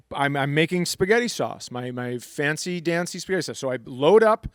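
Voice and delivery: ordinary talk, nothing marked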